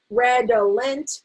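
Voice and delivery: redolent slowly as red-o-lent, last vowel a short e; slow, unreduced red-o-lent; full syllables. Shown as a demonstration. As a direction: sound out every vowel of redolent. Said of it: "my vowel is short".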